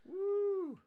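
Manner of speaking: high, loud cheer; cheering whoop. Real, not acted. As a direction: whoop for a friend. Cheering friend.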